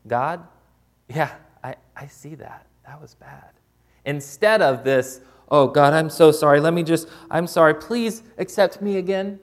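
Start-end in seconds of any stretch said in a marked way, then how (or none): 0.1-3.5 hushed, matter-of-fact admission to God; calm confession. Acted. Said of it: "Your confession looks more like this". 5.5-9.4 loud, higher-pitched mock begging; pleading confession. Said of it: "that's how my confessions look like".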